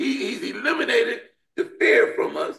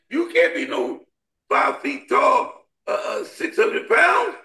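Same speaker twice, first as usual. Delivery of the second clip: ordinary talk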